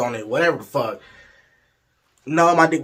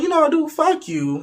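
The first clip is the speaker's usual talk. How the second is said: high, exasperated mock cursing; cussing out; 14 semitones higher, 4 dB louder than usual. Storyteller cusses out his date while leaving, mock-angry. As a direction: act out telling someone off. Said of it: "We cussing each other out".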